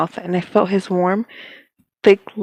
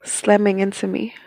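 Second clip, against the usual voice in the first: usual speaking voice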